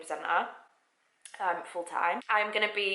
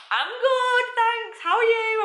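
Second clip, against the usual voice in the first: very high, louder squeaky reply; nervous over-polite reply; 5 dB louder, slower than usual. Retold or imitated. Speaker answers the big boss, mock high nervous politeness.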